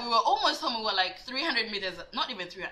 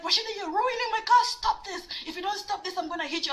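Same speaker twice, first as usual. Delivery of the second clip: high, frantic imitation of boyfriend; mock alarmed threat, pitched up; protesting boyfriend; 10 semitones higher, faster than usual. Acts out boyfriend protesting the wrecking.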